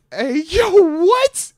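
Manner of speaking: high, loud, stunned "what?"; loud incredulous yelp; shocked outrage. Retold or imitated. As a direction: jump up in disbelief. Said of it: "and I'm like".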